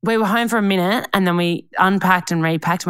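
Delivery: ordinary talk, nothing marked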